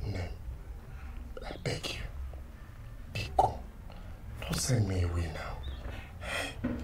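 slow, breathless whispered begging; hushed desperate plea; pleading whisper. Acted. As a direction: beg softly, barely voiced, afraid to be sent away. Begging not to be sent away.

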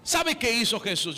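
usual speaking voice

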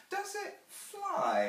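put-on very high, drawn-out child's question; artful child. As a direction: ask slowly like an artful little girl. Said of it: "asks the artful child".

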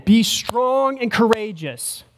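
slow, deliberate emphasis on every word; emphasized. Shown as a demonstration. Preacher stressing God's command to the congregation, slow emphasis. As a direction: lean into each word, slowly. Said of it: "He emphasizes this point again".

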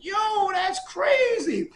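high, slow, loud yell of amazement; amazed shout. Retold or imitated. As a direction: shout out in disbelief. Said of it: "he got that voice".

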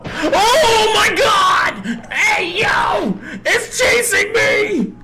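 terrified yell as it chases you; scared horror game streamer. Real, not acted. Deer suddenly chases him.

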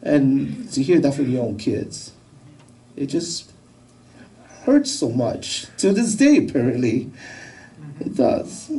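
halting, voice giving way; choked up. Real, not acted. Father recalling words from his own kids, choked up. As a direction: push haltingly through real hurt. Father overcome by daughter's rejection.

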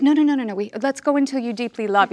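lively, pleading no no no; eager protest. Retold or imitated. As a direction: playfully beg for another take. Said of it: "And you're like".

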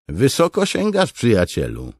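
high, loud ribbing call; teasing soldier. Acted. Soldier ribbing Kaladin about Amaram, high loud tease. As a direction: tease him for aiming too high.

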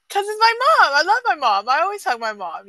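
loud, high-pitched comeback, easing down; emphatic retort. Retold or imitated. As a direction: fire back at puzzled friends, then settle. Retorting to puzzled friends in retelling.

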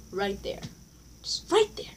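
breathy whispered aside. Real, not acted. Whispering an aside to the viewers. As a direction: drop to a secret whisper. Aside after fake names.

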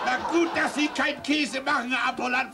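high, loud roaring jeer; loud high outburst mocking from the cold; mocking shout. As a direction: shout the joke down. Uproar, mocking the explanation.

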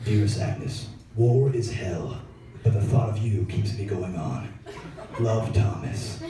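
low, slow, solemn letter voice; hammed-up solemn soldier writing home; old-timey soldier letter. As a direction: read it like a grave wartime love letter. Grandpa's wartime love letter voiced.

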